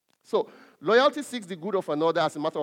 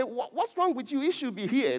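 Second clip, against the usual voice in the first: mildly furious, raised-pitch questioning; mock furious; 8 semitones higher than usual. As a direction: act out a father's angry outburst, pitched up. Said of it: "if he becomes furious and say".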